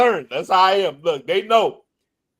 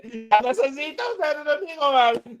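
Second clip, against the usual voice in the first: higher, softer Dominican Spanglish; longing Dominican Spanglish; Dominican accent; 7 semitones higher, 6 dB quieter than usual. Retold or imitated. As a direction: sigh it out like a homesick Dominican. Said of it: "Look, I start talking".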